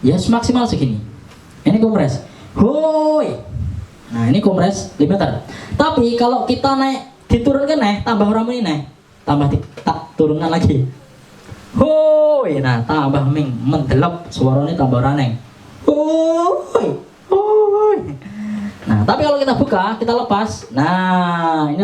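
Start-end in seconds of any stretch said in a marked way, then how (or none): 2.6-3.4 high shouted "Hoi"; test shout. Shown as a demonstration. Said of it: "saya teriak kayak gimana pun". 11.7-12.6 high, loud "Hoi"; test shout. Shown as a demonstration. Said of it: "saya teriak kayak gimana pun". 15.9-17.0 high held "Hoi"; test shout. Shown as a demonstration. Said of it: "saya teriak kayak gimana pun". 17.3-18.0 very high, loud "Hoi"; full test shout. Shown as a demonstration. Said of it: "saya teriak kayak gimana pun".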